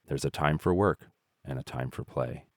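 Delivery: ordinary delivery, nothing marked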